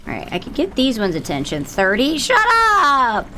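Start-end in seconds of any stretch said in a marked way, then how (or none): 2.2-3.3 sharp yell. Real, not acted. Snapping at chat.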